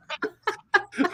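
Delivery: helpless high laugh; high burst of laughter. Real, not acted. Laughing at the boy's request.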